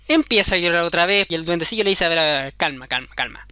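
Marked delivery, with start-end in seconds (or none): 2.0-3.4 low, soft hush; calm her down in a low soothing voice; the goblin hushing the crying girl. Acted.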